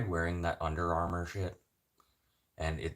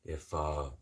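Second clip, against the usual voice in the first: normal talking voice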